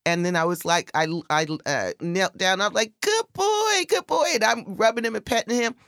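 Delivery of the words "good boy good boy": put-on high, sing-song good boy; high doggy praise; praising the dog.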